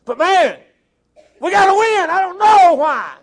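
high, hammed-up yelling at the game; mock angry fan. Preacher imitating fans of a losing team, mock shouting. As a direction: holler like an outraged fan whose team is losing. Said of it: "when they're losing, they're like".

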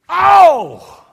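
one long, high, loud "oh" falling away; big mock howl of pain; acted pain cry. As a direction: bellow as if you just hurt yourself. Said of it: "hit yourself and you go".

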